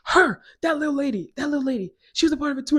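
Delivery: high, loud mock shriek of accusation; hammed-up high, shouted finger-pointing; accusing lady. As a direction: yell it out like an outraged accuser. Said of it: "this one lady from one of the families goes".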